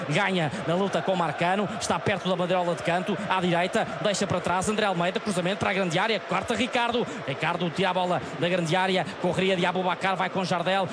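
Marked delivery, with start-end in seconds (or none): none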